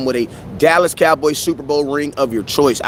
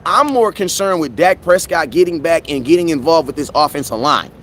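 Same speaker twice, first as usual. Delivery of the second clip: normal talking voice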